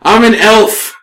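loud, high comic elf retort; haughty elf. Acted. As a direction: play an affronted elf, amused underneath. Game master answers as the elf.

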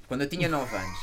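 ordinary talk

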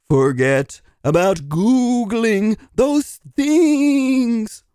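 very slow, drawn-out hypnotic chant; hypnotist. Acted. Applicant trying to hypnotize the interviewer, slow and hypnotic. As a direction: stretch every word as if putting them under. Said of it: "Can I hypnotize you?".

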